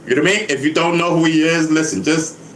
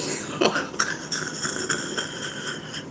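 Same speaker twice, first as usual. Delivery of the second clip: quiet, breathless laughing fit; long airy cackle, very soft; wheezing laugh; 10 dB quieter than usual. Real, not acted. Amused by listener's explanation.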